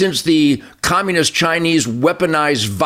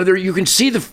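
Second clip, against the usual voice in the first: usual speaking voice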